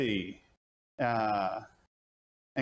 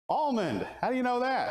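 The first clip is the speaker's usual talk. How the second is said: mock surprise; 11 semitones higher than usual. Answers then feigns surprise.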